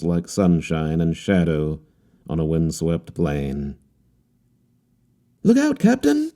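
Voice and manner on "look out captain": much higher put-on voice, called out; a young man shouting a warning; cried warning. A narrator's reading. Harlequin calling a warning to the captain, high and urgent. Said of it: "he cried".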